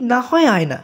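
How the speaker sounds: ordinary delivery, nothing marked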